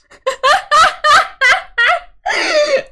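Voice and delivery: high, loud burst of laughter; loud laughing fit. Real, not acted. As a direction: cackle at yourself. Laughing at own impulse buy.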